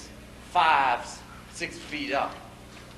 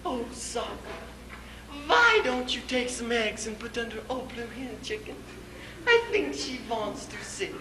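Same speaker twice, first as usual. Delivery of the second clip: soft, higher wifely coaxing; sweet voice; 6 semitones higher, 6 dB quieter than usual. Acted. Wife asking husband about the hen once more, sweet. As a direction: coax sweetly and softly, pitched up. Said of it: "in a sweet voice".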